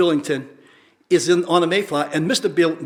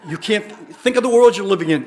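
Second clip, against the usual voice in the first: ordinary talk, nothing marked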